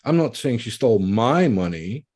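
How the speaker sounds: big emphasis on "my"; stress on MY. Shown as a demonstration. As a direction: hit "my" as the disputed word. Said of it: "it was someone else's money".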